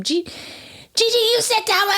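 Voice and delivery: high, slow shrill-teacher voice; mock teacher scream. Teacher yelling at my niece, high mock scream. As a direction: imitate the mean teacher barking at a child. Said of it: "And she screamed at her".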